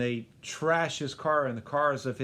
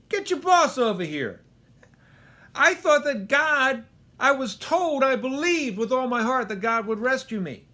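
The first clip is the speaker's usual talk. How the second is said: much higher, louder, indignant demand; with attitude; 9 semitones higher, 7 dB louder than usual. Retold or imitated. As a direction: storm up and demand the boss. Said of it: "he's got a little bit of an attitude".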